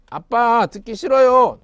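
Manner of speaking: put-on whiny child. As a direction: whine back like a stubborn child. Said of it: "라고 말하면서 아빠 말을 안 들었다면".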